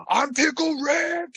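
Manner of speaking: high-pitched, drawn-out catchphrase yell; imitated fan yell. Kid runs into a McDonald's yelling his catchphrase, mock yell. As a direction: squeal it out slowly like an obnoxious fan.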